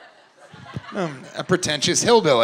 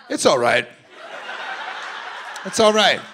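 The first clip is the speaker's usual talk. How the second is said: dad soothing; 3 semitones higher than usual. Retold or imitated. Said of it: "this guy in the middle is like".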